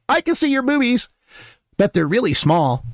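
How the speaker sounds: high child imitation, easing down; little girl. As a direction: tease like a blunt small kid. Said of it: "She goes".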